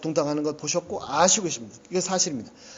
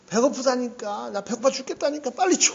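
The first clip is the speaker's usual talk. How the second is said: 징징대는 아이 흉내; 4 semitones higher than usual. Retold or imitated. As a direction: whine like a kid nagging mom for food. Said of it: "막 징징대요". (배고프다고 징징대며 조르는 아이 흉내)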